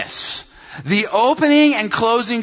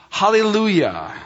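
usual speaking voice

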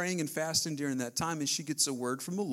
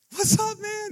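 bright friendly greeting; 8 dB louder, slower than usual. Retold or imitated. Greeting the kid, retold.

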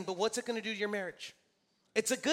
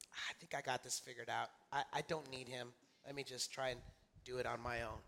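low, soft imitation of self-talk; quiet self-reliance; 8 semitones lower, 11 dB quieter than usual. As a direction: voice someone privately talking themselves into it. Imitates someone quietly drifting from faith.

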